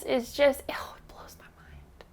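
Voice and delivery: words barely getting out through tears; overwhelmed whisper. Real, not acted. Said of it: "I'm gonna cry again".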